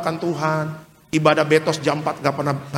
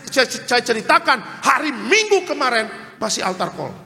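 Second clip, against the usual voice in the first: raised, insistent preacher's voice; fervent preaching; 9 semitones higher than usual. Acted. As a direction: press the warning home. Pastor preaching at full force.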